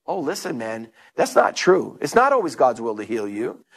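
low, dismissive correction; imitated doubting friend. Preacher voicing a friend discouraging the healed lady, low and dismissive. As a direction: drop the pitch and gently put them straight.

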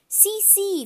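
put-on high, loud toddler glee; delighted small-child squeal of words; gleeful little kid. A narrator's reading. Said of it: "Fudge laughed".

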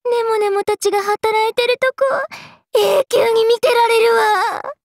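swooning squeal, held long and high; gushing adoration. Acted. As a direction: melt over something adorable. Melts over cute little workers.

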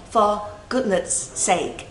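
slow, spaced "for goodness sake"; slow pronunciation model. Shown as a demonstration. Teacher models lyric for learners, "for goodness sake" slowly spaced. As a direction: draw out each word of "for goodness sake".

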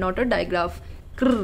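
usual speaking voice

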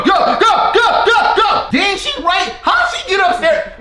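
high, loud frantic urging; excited yelling. Real, not acted. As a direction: yell them on in a panic. Granny chasing in game.

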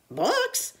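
high puzzled rise; confused question. A narrator's reading. Captain hears about books: high puzzled question. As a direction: ask it like you've never heard of books.

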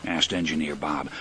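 usual speaking voice

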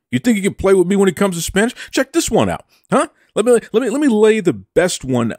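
high-pitched, cocky showing-off; hammed-up boastful taunt; mock challenge. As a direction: fire it out high, like a playful dare. Mock boast challenging Marie over Spanish.

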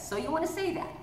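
normal talking voice

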